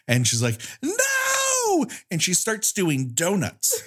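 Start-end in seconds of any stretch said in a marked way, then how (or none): 0.8-1.9 high shrill no; mock scream. Barbara told bad news, high mock shriek. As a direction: yelp it like a horror heroine. Barbara's horrified reaction, retold.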